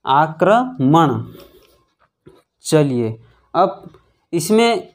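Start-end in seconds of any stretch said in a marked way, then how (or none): 0.0-1.2 आक्रमण drawn out slowly, each syllable clear; careful teacher's आक्रमण; slow sounding out. Shown as a demonstration. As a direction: say आक्रमण slowly, aa-kra-man. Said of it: "तो ये हो गया है दोस्तों हमारा".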